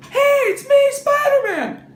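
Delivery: the identical very high, loud, excitable squeal; Spider-Man. Acted. Comic showing Spider-Man sounds exactly like Peter, high squeal. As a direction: shout it in a high-pitched kid hero voice. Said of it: "and he talks like this".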